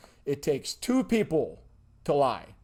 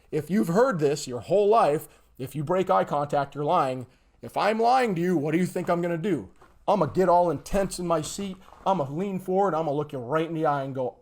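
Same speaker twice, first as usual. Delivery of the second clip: normal talking voice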